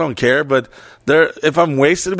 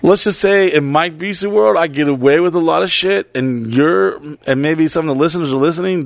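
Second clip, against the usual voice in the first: ordinary delivery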